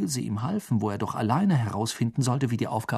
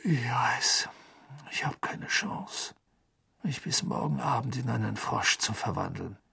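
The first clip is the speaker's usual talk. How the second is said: resigniert; 7 dB quieter than usual. A narrator's reading. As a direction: sigh it out quietly, without hope. Harry gibt erschöpft die Hoffnung auf. (leise, seufzend, mutlos)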